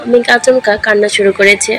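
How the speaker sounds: usual speaking voice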